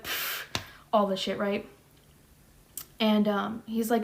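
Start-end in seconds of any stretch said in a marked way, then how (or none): 0.0-0.6 dismissive scoff. Retold or imitated.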